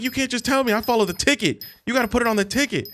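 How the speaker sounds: high fast lecturing cook; mock cook. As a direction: rattle it off as the cook. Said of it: "And he's like".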